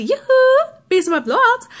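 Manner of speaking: high, loud singsong catchphrase; mimicking alarm. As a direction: chirp it like an annoying salesman alert. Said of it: "change it back to".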